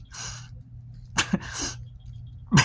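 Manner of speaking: real laugh through tears; soft unvoiced laugh while crying; tearful breathy laugh. Real, not acted. Speaker laughing at himself while tearing up, soft.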